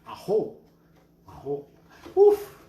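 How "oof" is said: sudden high loud oof, emotionally struck; high loud gasp of being moved. Real, not acted. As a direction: let out a startled oof of emotion. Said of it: "That just touched my heart".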